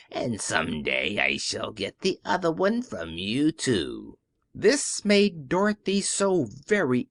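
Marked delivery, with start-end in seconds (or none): 0.1-4.2 put-on low witch voice, taunting the girl; a wicked old witch gloats over a child; low sneering witch taunt; mocking witch. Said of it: "laughing at her".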